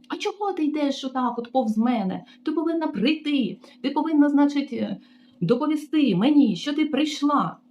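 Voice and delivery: high, bossy scolding mimicry; mocking the receptionist. Receptionist scolding the new maid, high bossy mimicry. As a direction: lecture her like a petty tyrant.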